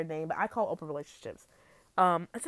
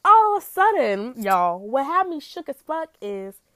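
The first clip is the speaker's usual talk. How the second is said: dramatic buildup; 5 semitones higher, 11 dB louder, slower than usual. Real, not acted. Building up the story's twist.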